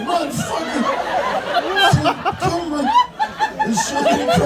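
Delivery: high, strained cursing; pained straining. Acted. Fighting a leg cramp mid-sex, high pained straining. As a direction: curse through a seizing cramp.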